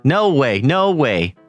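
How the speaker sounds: plain reading voice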